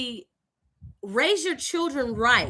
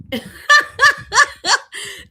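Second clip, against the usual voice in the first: big laugh at herself; loud high laugh; 14 semitones higher, 9 dB louder than usual. Real, not acted. Storyteller cracking up at her own joke, loud laugh. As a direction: burst into a high cackle.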